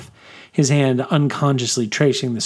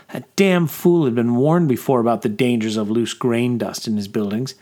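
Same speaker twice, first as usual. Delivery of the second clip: ordinary delivery, nothing marked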